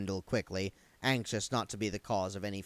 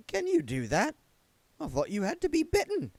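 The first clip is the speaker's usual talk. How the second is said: higher, timid zombie clerk; a nervous, polite little man, pitched up; Wendell's voice; 3 dB louder than usual. A narrator's reading. Wendell's character voice.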